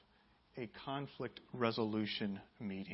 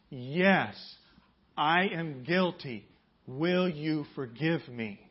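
slower still, louder, leaning on each word; slow call and response; 7 dB louder, much slower than usual. Shown as a demonstration. Pastor repeating the confession with the congregation, slow and louder. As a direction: say it again, slower and firmer.

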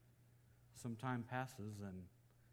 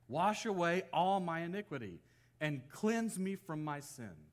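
raised, declaimed psalm with weighty pauses; scripture reading; 4 semitones higher, 5 dB louder than usual. Acted. Preacher reads David's psalm of repentance, declaimed plea. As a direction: proclaim the plea, louder and higher, phrase by phrase.

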